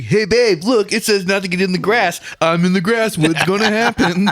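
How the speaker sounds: high, cocky, carefree mock voice; mocking a reckless friend. Teasing Jordan by voicing him, mock carefree. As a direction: play the clueless daredevil calling to babe. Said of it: "you would go on some fucking stupid trick and be like".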